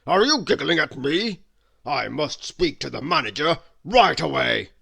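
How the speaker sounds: loud, offended gruff voice; loud indignant complaint; cross shout. A narrator's reading. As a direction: bellow at the giggling waitress. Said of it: "he asked crossly".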